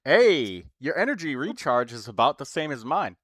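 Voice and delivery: normal talking voice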